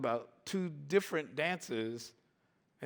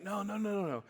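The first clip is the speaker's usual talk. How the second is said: mock pleading; 8 semitones higher than usual. Begging at the slammed door.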